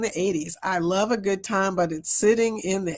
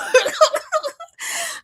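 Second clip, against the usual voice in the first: high laugh. Real, not acted. Laughing at her own story.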